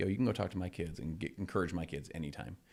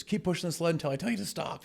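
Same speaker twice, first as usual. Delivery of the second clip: put-on raised, high barking order to a kid; bossy dad shout; 11 semitones higher, 5 dB louder than usual. Dad shouting at his son pushing the sled, a mock bark. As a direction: call out like a stern dad at the gym.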